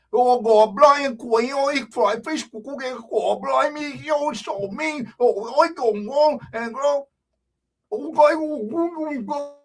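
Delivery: exaggerated, high Bajan gabble; garbled mock Bajan with rounded vowels; Bajan accent. Imitating Bajans talking, broad Bajan accent. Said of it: "you ever hear the Bajan there?".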